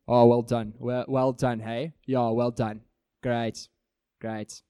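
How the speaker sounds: dry, sarcastic praise that belittles. Shown as a demonstration. Preacher demoing condescending praise to someone. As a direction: say well done but mean the opposite. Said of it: "we use sarcasm and we can say the good thing but be meaning the bad thing".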